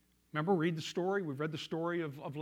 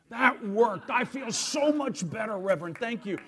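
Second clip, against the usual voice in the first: relieved, grateful outburst; 4 semitones higher, 9 dB louder than usual. Retold or imitated. Relieved father thanking the reverend.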